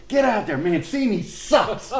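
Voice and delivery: high, drawn-out mock holler; retold yell. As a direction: heckle from across the field. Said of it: "he yells".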